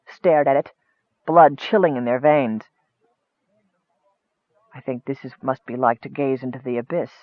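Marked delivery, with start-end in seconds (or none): 4.7-7.1 low, soft, awestruck voice; awed. A narrator's reading. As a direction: speak low and hushed in awe. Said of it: "said Michael in awe".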